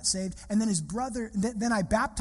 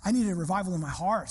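ordinary talk

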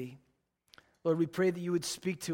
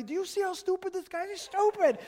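much higher, exasperated cab driver voice; griping New York cabbie; 14 semitones higher than usual. Retold or imitated. Cab driver ranting to the pastor about another driver, exasperated. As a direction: complain to your passenger like a hot-headed cabbie. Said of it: "he's telling me".

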